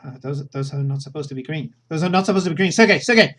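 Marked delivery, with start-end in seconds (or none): 1.9-3.3 loud and high, alarm breaking out; alarmed shout. Acted. She yells for Sergei as the lights go wrong, loud and high. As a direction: call out in sudden alarm at the green lights.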